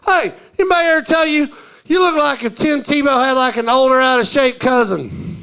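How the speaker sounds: a stranger's excited hail, imitated; calling out to a stranger. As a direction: holler it like a pushy passer-by. Mimics passers-by hailing him.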